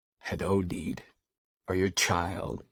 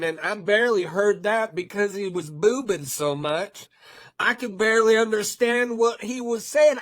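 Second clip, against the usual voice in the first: high, loud, scoffing mockery; dismissive critic; 11 semitones higher, 4 dB louder than usual. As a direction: sneer it like a rude commenter. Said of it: "I know you're just like".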